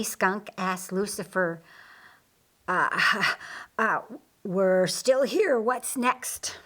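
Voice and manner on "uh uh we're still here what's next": halting nervous little laugh, then slow; unsure, stalling with a nervous chuckle; hesitant. Acted. Skunk nervously asks Lucifer what comes next, hesitant.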